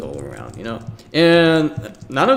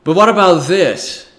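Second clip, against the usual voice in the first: higher and louder, suddenly bursting in with an idea; eager interjection; 7 semitones higher, 10 dB louder than usual. Retold or imitated. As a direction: pitch a new idea with sudden excitement. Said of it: "And then someone says".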